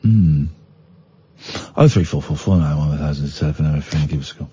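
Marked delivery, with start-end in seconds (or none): 1.8-4.5 low, soft radio-host plug; a quick station plug read to listeners. Acted. DJ plugs the phone line in a low, soft voice. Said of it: "reads out phone number".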